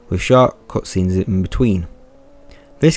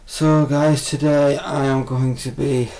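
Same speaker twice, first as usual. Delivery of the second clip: strained, higher voice, hurting; speaking through pain; 3 semitones higher, slower than usual. Acted. Reviewer presenting to viewers while in pain. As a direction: push the words out through pain. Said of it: "through the pain".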